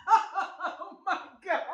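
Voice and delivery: high laugh spilling into a slow "Oh my God"; helpless giggly "Oh my God"; embarrassed laughter. Real, not acted. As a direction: laugh through your embarrassment.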